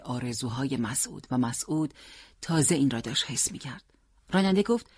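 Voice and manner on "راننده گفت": plain reading voice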